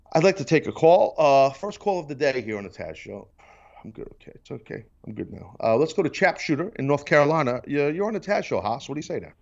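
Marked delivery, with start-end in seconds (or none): 3.8-5.6 much softer and lower; talking himself down after the outburst, softly; calming down. Real, not acted. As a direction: settle yourself, quietly.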